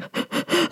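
high, breathy mock sob gasp; tearful gasp. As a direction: gasp as if crying. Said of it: "Literally sobbing through the tears".

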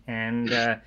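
normal talking voice

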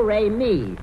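normal talking voice

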